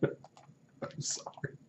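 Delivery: breathless whispered laugh; can't stop laughing; real laughter breaking through; laughing apology. Real, not acted. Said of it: "This is just too good".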